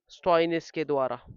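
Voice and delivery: ordinary talk, nothing marked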